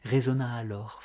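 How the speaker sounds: ordinary delivery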